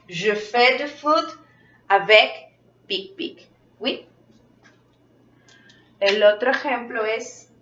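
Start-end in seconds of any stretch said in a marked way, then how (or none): none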